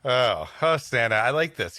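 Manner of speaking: normal talking voice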